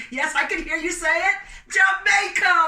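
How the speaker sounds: high, loud triumphant call; triumphant shout. Real, not acted. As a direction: crow out the answer. Answering audience's shouted guess.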